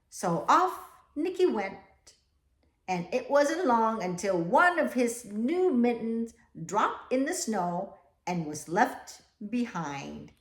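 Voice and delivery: put-on slow, even read-aloud to children; storybook reading. A narrator's reading. Teacher reading the class a picture book, slow storytelling.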